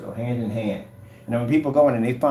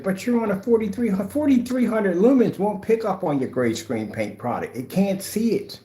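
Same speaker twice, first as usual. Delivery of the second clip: mocking skeptic; 10 semitones higher than usual. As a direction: mimic a doubting critic. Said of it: "we get those individuals say".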